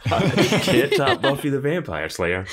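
loud, high and laughing; laughing mock answer. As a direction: draw it out slowly through laughter.

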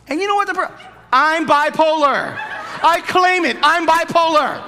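high, loud, laughing preacher; preaching at full force. Acted. Pastor mocking those who claim illness, loud laughing preaching. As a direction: shout it with a laugh at the crowd.

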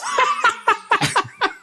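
very high burst of laughter; giggling at his own puppy voice; high giggle. Real, not acted. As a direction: break into a squealing giggle.

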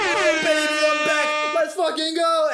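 high, loud excited yell; hype shout. Real, not acted. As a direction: cheer the comeback. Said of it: "celebrating comeback".

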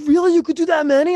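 very high incredulous question; squeaky-high comic astonishment; mock disbelief. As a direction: imitate an astonished, worried rival. Retelling rival's disbelief at rep count.

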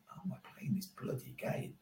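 hushed inner worry; barely audible worried whisper; whispered thought. Retold or imitated. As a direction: whisper it to yourself in disbelief.

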